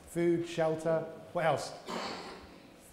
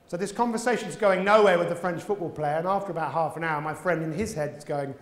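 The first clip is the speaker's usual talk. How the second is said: ordinary delivery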